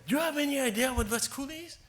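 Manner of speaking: high, mocking retelling of the principal; a mock-incredulous headmaster; principal's mocking question. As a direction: voice him up high, scoffing at the idea. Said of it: "He really laughed his head off".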